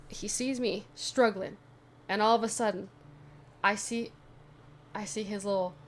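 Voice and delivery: ordinary talk, nothing marked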